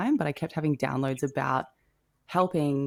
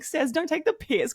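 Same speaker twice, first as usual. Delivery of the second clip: high, slow playful scolding; mock scolding a new friend; teasing; 13 semitones higher than usual. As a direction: tease her gently, drawn out. Teasing friend over perfume.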